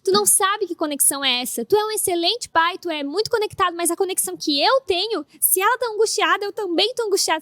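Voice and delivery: wife to husband, hammed-up drama; dramatic. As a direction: play it like a telenovela heroine. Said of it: "Aí o drama".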